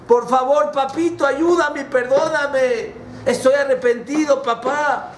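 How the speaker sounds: high, imploring call; acted desperate plea; son crying out. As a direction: beg from afar in a very high voice. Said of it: "Se escucha la voz de mi hijo".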